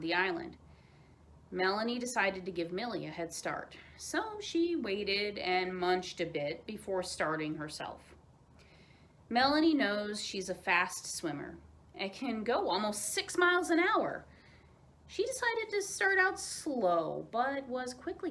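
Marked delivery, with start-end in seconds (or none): none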